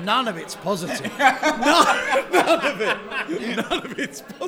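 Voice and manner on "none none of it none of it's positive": laughing. Real, not acted. Laughing at a friend's blunder.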